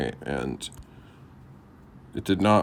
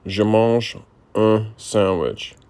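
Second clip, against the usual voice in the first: slow repetition; slower than usual. Shown as a demonstration. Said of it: "repeats slowly after tutor".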